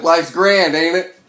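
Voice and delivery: loud, high, bitter mock cheer; loud sarcasm. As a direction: toss it off with sour irony. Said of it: "I was like".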